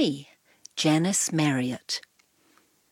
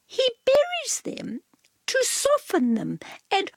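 much higher, light cat voice; prissy cat explaining; Cat's voice. A narrator's reading. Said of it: "Cat continues".

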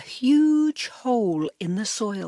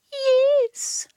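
purred; 5 dB louder than usual. A narrator's reading. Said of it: "Cat purred".

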